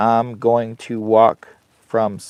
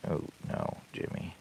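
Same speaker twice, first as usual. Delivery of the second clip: whispered oh no; 5 semitones lower, 17 dB quieter than usual. Real, not acted. Slip while burning wood.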